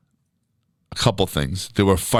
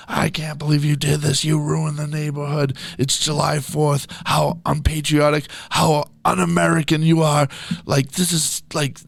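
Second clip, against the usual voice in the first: mock screaming neighbor; 8 semitones higher than usual. Imitating neighbor scolding party hosts, high mock screaming. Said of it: "we just all heard her screaming".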